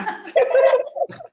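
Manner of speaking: high, loud burst of laughter. Real, not acted. Classmates tease the angry one, high loud laughing.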